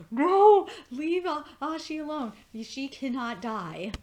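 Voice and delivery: loud, high wail easing down into slow insistence; protesting cry. Retold or imitated. Viewer begging the villain to spare Ashi, dramatic protest. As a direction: yell no, then plead for her life. Acting out own reaction to Ashi's danger.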